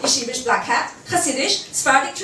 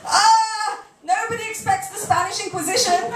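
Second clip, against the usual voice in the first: mock alarm; 8 semitones higher than usual. Quoting Monty Python gag.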